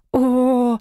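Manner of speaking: high, restrained squeal of delight; thrilled "oh", pitched well up; excited squeal. A narrator's reading. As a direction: let out a small delighted squeal. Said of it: "взвизгнула я".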